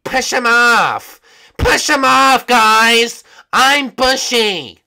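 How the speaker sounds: put-on high, slow, loud old-librarian voice; Bushy impression. Showing the lobby what Bushy sounds like. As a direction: stretch it out like a squeaky old librarian. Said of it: "that's how Bushy sounds".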